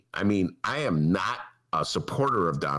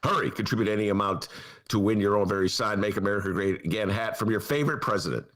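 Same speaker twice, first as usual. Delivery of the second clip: rushed read; faster than usual. Retold or imitated.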